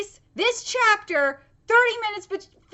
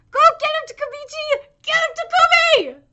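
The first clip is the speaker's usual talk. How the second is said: loud, high-pitched rallying cry; cheering shout; 10 semitones higher, 6 dB louder than usual. Real, not acted. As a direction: cheer your fighter on at full voice. Cheering on the character.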